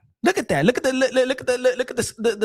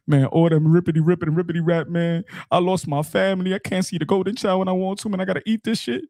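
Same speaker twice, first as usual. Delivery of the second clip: mock sad husband; 6 semitones lower than usual.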